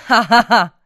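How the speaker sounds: high mocking cackle "ha ha ha"; mocking laugh. Ducks jeering at the ugly duckling, high mocking laugh. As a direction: laugh at him like a bully.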